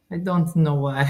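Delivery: imitated Italian accent. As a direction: say it like an Italian woman. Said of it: "I need her Italian accent".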